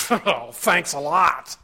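drawn-out, sneering "Oh, thanks a lot"; mock gratitude dripping with sarcasm; sarcastic thanks. Shown as a demonstration. Preacher showing the congregation a bad tone, sarcastic. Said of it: "The tone makes a difference".

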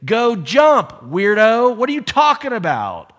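high, loud, incredulous brush-off; scornful dismissal. Acted. Sailor answering Jonah's plea to be thrown over, scornful. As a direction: dismiss him like he's talking nonsense.

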